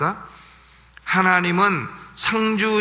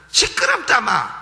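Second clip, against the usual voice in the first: higher, blunt Gyeongsang dialect rebuke; Gyeongsang retort; 7 semitones higher, 3 dB louder than usual. Retold or imitated. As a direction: shut the voice up, Gyeongsang style. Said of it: "이 여자가 경상도 여자예요".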